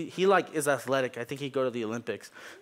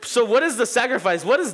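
laughing through the words, louder and higher; cracking up at his own joke; giggly, loud, real laughter; 9 semitones higher, 7 dB louder, slower than usual. Real, not acted.

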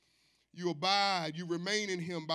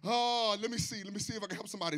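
ordinary delivery

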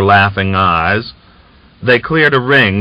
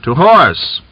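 louder, higher order; command; 7 semitones higher, 5 dB louder than usual. A narrator's reading. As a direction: call the troop to mount. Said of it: "Fomin gave the order".

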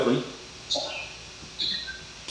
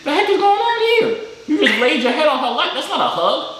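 mock outrage; 11 semitones higher than usual. Real, not acted. Mock outrage at bad hug.

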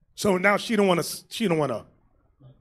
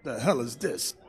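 low, much softer grumble under the breath; muttered aside; 11 semitones lower, 10 dB quieter than usual. Real, not acted. Catchphrase dropped low and soft.

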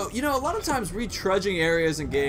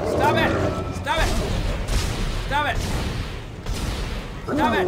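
being chased; 3 semitones higher than usual. Real, not acted. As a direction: yell it while running away. Being chased by immortal enemy.

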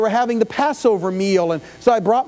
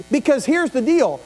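ordinary delivery

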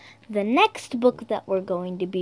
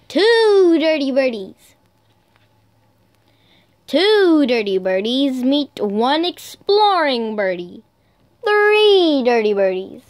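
animated picture-book read, some lines higher and slow; reading to children; 4 semitones higher, 3 dB louder than usual. Acted. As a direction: count the birdies up with growing excitement. Reads picture book aloud.

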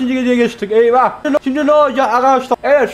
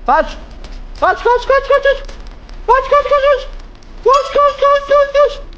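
panicked shouting; slower than usual. Real, not acted. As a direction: scream the word in panic as you run. Chased, urging himself to flee.

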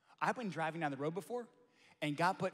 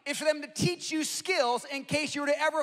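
much higher and louder, ringing out; full-force preaching; 9 semitones higher, 10 dB louder than usual. Acted. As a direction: lift the room with it.

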